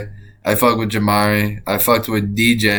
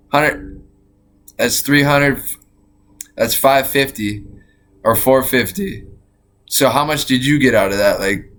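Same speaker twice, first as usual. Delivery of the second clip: usual speaking voice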